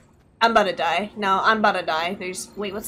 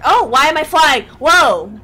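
loud, higher startled exclamation; 5 semitones higher, 8 dB louder than usual. Real, not acted. Gamer suddenly flying on a glider, loudly startled. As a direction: blurt it out in loud surprise.

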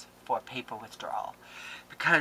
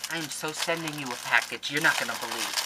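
ordinary talk, nothing marked

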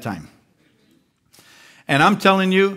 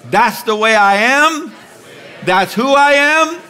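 bold declaration; 4 semitones higher than usual. Shown as a demonstration. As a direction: proclaim it with growing conviction. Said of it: "Just say it out loud".

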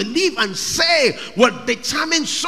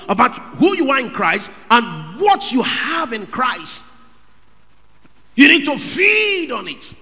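fiery sermon climax; preaching at full force; 5 semitones higher, 7 dB louder than usual. Real, not acted. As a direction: thunder it over the congregation.

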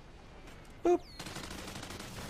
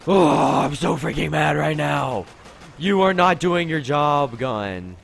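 loud, higher mad rant; angry; 4 semitones higher, 7 dB louder, slower than usual. Real, not acted. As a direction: scold the gun furiously. Angry at his gun.

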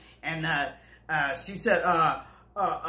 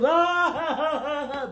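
mock laugh; 7 semitones higher than usual. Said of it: "bust out laughing".

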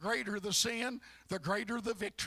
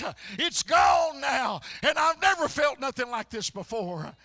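preaching at full force; 4 semitones higher, 7 dB louder than usual. Real, not acted. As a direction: pile each all of that into a shout. Preaching crescendo of release.

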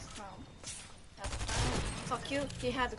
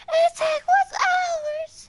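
shouting; 15 dB louder, much slower than usual. Acted. Hyped mock motivational speech.

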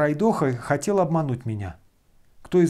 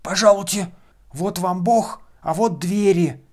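put-on old servant voice; 6 semitones higher, 4 dB louder than usual. A narrator's reading. Servant Zakhar shows him out.

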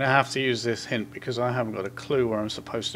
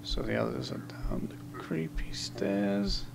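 whisper; 9 dB quieter than usual. Real, not acted. Creeping down creepy stairs.

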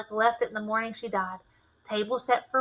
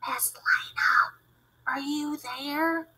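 whisper; 5 semitones higher, slower than usual. A narrator's reading. Kid whispering into a dark shed for Miss Lena, hushed.

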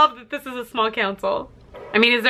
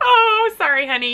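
ordinary talk, nothing marked

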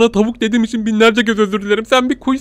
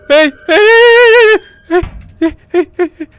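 terrified screaming; 12 semitones higher, 8 dB louder than usual. Real, not acted. Trapped, monster coming.

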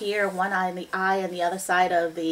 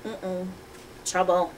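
usual speaking voice

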